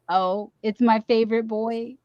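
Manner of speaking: ordinary talk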